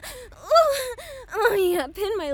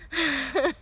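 breathless laugh; 4 dB quieter than usual. Acted. Breathless laugh after climax.

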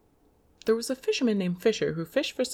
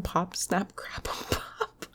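whispering; 4 dB quieter, slower than usual. Real, not acted. Whispered laughing attempt.

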